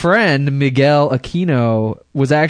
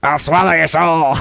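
hammed-up horror demon voice; Evil Dead demon; 3 semitones higher, 3 dB louder than usual. Co-hosts picture Evil Dead gore, mock demon voice. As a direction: menace like a possessed movie demon.

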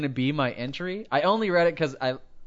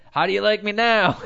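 taunting quote; 7 semitones higher, 6 dB louder than usual. Retold or imitated. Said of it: "the accused said".

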